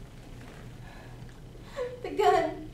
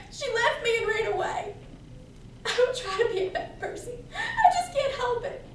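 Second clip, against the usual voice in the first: high, loud, gasping through tears; sobbing confession; 9 semitones higher, 6 dB louder than usual. Acted. Girl confesses to beekeeper she killed her mother, sobbing. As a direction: break down more with each admission, voice climbing.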